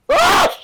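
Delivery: sudden very high scream; startled scream. Real, not acted. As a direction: shriek at the sudden fright. Scared while looking in horror game.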